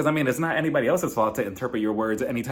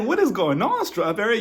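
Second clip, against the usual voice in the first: mock shock; 7 semitones higher than usual. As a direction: react as if stunned. His shocked reaction.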